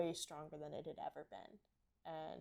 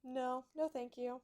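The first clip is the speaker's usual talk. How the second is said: higher, mock-polite horse refusal; voicing the horse; 6 semitones higher, slower than usual. Horse turning face away from owner's hand, higher mock-polite voice. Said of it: "He's like".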